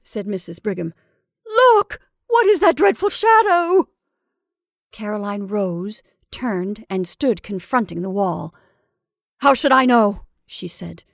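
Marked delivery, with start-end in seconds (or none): 1.5-3.9 high, loud burst of horror; horrified cry. Acted. Mrs Brigham sees the dreadful shadow, horrified cry. 9.4-10.2 irritated retort. Acted. Caroline snaps back at sister.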